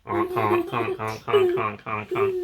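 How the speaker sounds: high squeaky clown horn honks; silly clown. Pretend. Clown horn noises for child.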